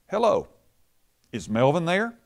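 bright, high, friendly phone greeting; cheerful prank caller. Retold or imitated. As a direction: ring up all innocent and chipper. Father's prank call.

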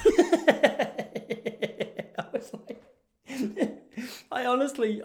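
high, helpless real laughter between words; cracking up. Real, not acted. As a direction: try to talk through your own laughing. Cracks up after own punchline.

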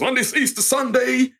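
a hint of a preacher addressing the congregation; preacher's call. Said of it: "the preacher's at the front and goes".

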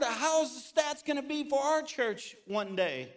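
high, loud preaching build; preaching at full force. Real, not acted. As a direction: rise into an urgent appeal. Preaching climax, rising intensity.

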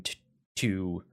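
hiccuping mid-sentence; words broken by hiccups. Real, not acted. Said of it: "I have the hiccups".

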